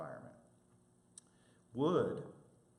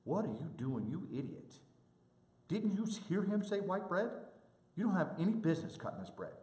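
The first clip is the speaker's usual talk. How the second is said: coworker going off on a colleague, pitched up; mock tirade; 8 semitones higher than usual. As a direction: scold him like he ruined the order. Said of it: "goes off on him".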